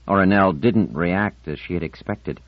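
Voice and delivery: ordinary delivery, nothing marked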